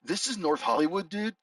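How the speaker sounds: hammed-up California surfer dude accent, "dude" tacked on. Mocking his own title read as a California surfer dude. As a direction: play a laid-back California surfer saying "This is North Hollywood, dude.". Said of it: "horrible, California surfer dude accent".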